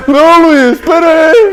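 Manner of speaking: high, whining plea to wait; imitated sufferer. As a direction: beg like a mock invalid trailing behind. Mimics sick man begging to wait. (choramingo agudo, imitando o doente)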